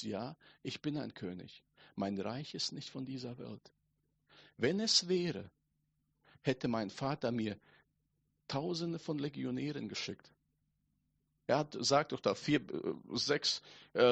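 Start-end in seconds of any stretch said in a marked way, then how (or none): 0.0-10.3 quiet, composed Jesus. Acted. Jesus answers Pilate about his kingdom, quiet and composed. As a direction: answer quietly, without raising the voice.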